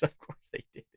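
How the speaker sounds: hushed, whispery scoff under the breath; scoffing aside. Real, not acted. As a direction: say it very quietly, as an aside. Scoffing aside while reading out.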